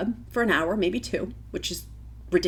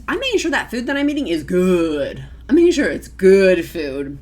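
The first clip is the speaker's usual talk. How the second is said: emphatic; 8 dB louder than usual. Real, not acted. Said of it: "emphatic repetition".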